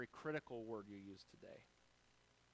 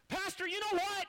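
high, loud mocking call of an upset person; mock upset shout; 8 dB louder than usual. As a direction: exaggerate their angry opener. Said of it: "That same person".

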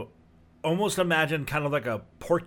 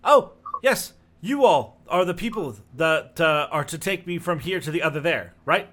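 lightly voiced halfling, higher and louder at the start; a hint of an eager little halfling calling out; halfling hailing the party from a distance; halfling NPC voice; 4 semitones higher, 6 dB louder than usual. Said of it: "in the distance says".

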